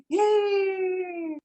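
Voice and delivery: very high, long drawn-out yay; child's cheer. Retold or imitated. Children contrasted with praying adults, gleeful squeal. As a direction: imitate an overjoyed kid.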